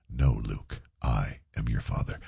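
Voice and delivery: low, soft villain voice through a stuffy nose; Darth Vader voice. Acted. Radio host jokes that his congested voice is a movie villain, low and soft. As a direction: drop it low and quiet like a masked villain. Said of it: "best Darth Vader impersonation".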